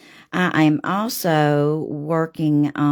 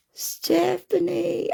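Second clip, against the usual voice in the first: called out much higher; excited greeting. Real, not acted. Painter greets a viewer by name, excited.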